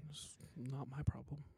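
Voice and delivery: unvoiced teasing whisper. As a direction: whisper it playfully.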